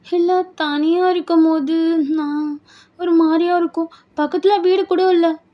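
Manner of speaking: high, slow hesitant plea; anxious bride. Acted. Bride dreading solitude in an isolated house, uneasy. Bride fears being alone.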